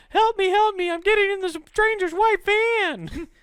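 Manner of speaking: high, loud mock plea; mock victim cry. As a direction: squeal for help, hammed up.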